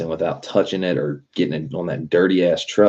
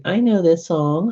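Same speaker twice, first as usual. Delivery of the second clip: much higher, put-on child's voice; little girl voice; 13 semitones higher than usual. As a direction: mimic a bored little girl. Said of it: "She's like".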